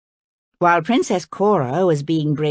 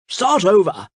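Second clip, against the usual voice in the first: mild shout of command; 3 semitones higher, 4 dB louder than usual. A narrator's reading. King ordering the skipper to begin again, shouting. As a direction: call it out like a strict coach. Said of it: "he shouted".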